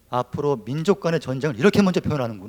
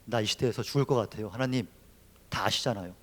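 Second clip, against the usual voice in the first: quiet plea; 3 semitones lower, 6 dB quieter than usual. Acted. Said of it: "이건 살려달라는 기도예요".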